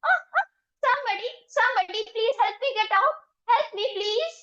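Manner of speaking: a mild mock scream of a trapped chick; chick screaming for help. A narrator's reading. Chicken stuck in the pot calling for help, high and frantic. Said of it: "started yelling and screaming again for help".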